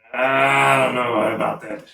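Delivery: slow, drawn-out doubt; doubtful guard. Acted. Guard answering through the door, doubtful. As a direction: draw it out as an unconvinced guard. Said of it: "All you hear is a".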